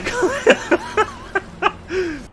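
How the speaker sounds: long, high peal of laughter; real laughing fit. Real, not acted. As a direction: lose it laughing mid-sentence. Cracks up denying he broke it.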